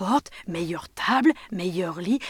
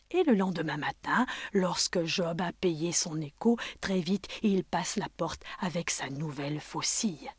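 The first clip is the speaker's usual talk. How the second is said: ordinary delivery, nothing marked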